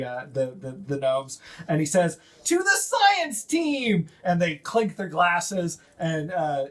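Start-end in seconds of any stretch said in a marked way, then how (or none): 2.4-4.1 high, slow, triumphant toast; high slow victory toast; gnome toast. Acted. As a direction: raise the glass and cheer it out high. Gnome toasts his team.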